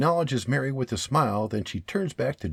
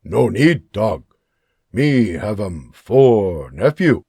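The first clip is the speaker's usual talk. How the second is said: Sanawa voiced; 3 semitones lower, 6 dB louder, slower than usual. Sanawa's broken English reply.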